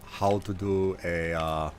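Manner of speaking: put-on Nord accent slipping into Schwarzenegger; failed Nord accent. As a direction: try a Nord accent, sounding accidentally Austrian. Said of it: "a Nord accent".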